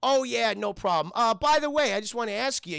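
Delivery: lightly acted breezy question; put-on casual. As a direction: act casual while fishing for information. Retold character feigns casual questions.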